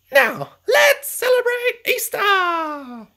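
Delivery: very high, loud, slow celebratory call; rally everyone to party; festive call. Acted. Joyful ending call.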